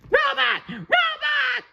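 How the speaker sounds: very high panicked shouting; yelling in panic. Acted. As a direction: scream the warning as you run. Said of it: "SpongeBob screaming".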